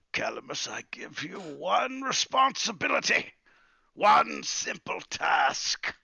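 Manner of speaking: exasperated lecture, slowing to a crawl on the last words; muttering angrily. Acted. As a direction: scold the quill, dragging out one simple task. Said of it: "mutters angrily".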